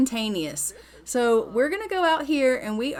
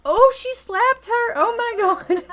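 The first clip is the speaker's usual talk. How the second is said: shocked; 12 semitones higher, 7 dB louder than usual. Real, not acted. Shocked at the slap.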